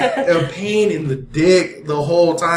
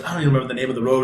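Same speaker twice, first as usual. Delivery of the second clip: normal talking voice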